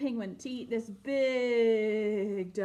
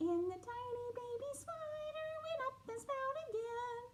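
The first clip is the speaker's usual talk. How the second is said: high tiny voice, much softer; tiny spider fading; 13 semitones higher, 8 dB quieter than usual. Acted. Finishing the tiny spider song softly for kids. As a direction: let the baby spider's song fade away. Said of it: "teeny tiny baby spider".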